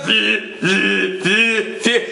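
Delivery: low repeated phone beeps; low-pitched mimicked beeping. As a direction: imitate a phone alert, evenly repeated. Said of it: "Your phone goes".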